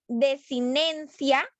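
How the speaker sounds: "desinencia" stretched out very slowly; slow, emphatic term. Shown as a demonstration. Teacher to class, slowly stressing "desinencia". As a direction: drag out "desinencia" syllable by syllable. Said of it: "deben tener la misma".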